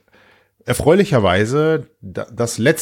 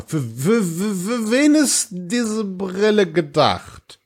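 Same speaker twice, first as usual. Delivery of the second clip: high, slow, spooked question; scared horror game streamer; 9 semitones higher, much slower than usual. Pretend. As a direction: ask it like something lurks nearby. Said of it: "deine Stille macht mir gerade Sorgen".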